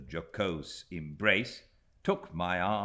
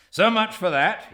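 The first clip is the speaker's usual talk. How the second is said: breezy dismissal; 15 dB louder than usual. A narrator's reading. Steerforth tossing off gloomy mood.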